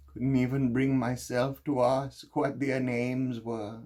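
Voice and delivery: loud, high-pitched outpouring of guilt; anguished confession. Acted. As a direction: pour out the guilt at full emotional pitch. Guilt-ridden confession at its emotional peak.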